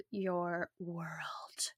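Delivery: slow breathy mock-seductive whisper. Host teasing her listeners, slow playful whisper. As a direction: whisper it slowly as a tease. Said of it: "not really".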